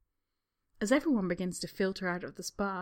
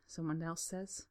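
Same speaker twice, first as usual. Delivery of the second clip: ordinary delivery, nothing marked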